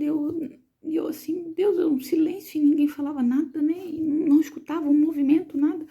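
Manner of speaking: mais aguda, aflita com o silêncio; aflição retomada. Retold or imitated. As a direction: reviva a angústia do silêncio. Silêncio estranho na sala de parto. (mãe aflita na sala de parto, assustada)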